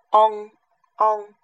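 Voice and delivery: "ong" called out loud and held, ending on a back nasal; model vowel sound. Shown as a demonstration. Teacher projecting the ong sound for students to copy, loudly. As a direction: sing out "ong" firmly for the class to copy. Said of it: "先发ong的音".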